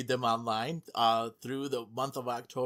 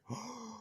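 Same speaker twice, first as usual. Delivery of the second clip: sharp quick intake of breath; host gasping at the new Switch rumor; surprised gasp; 10 semitones higher, 12 dB quieter than usual. Real, not acted. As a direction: gasp in shock at the news.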